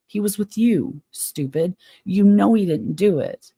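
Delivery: indignant, demanding where he was; getting mad. Real, not acted. Streamer ranting at Steven Avery, real anger. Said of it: "Woo, got me mad".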